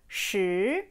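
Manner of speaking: "Shi" rising steadily; second tone. Shown as a demonstration. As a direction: glide "Shi" upward like a question. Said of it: "Deuxième ton qui monte".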